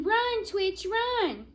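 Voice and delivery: high urgent yell. Acted. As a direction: animals yelling at the squirrel to flee. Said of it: "were yelling".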